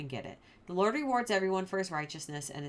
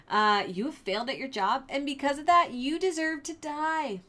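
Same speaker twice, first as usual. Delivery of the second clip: taunting; 7 semitones higher, 5 dB louder than usual. Retold or imitated. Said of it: "totally taunts him and teases him".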